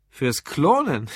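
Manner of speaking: slow, drawn-out surprised question; incredulous echo. A narrator's reading. Curious barman echoes the drunk's answer, incredulous. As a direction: stretch it out in amused disbelief. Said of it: "Das Gesicht des Barmanns veränderte sich".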